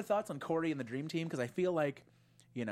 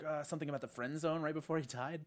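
ordinary talk

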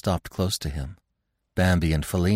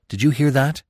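higher, louder alarmed question; a young deer crying out a warning; urgent cry; 7 semitones higher, 4 dB louder than usual. A narrator's reading. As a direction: call out in alarm. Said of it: "he cried to him".

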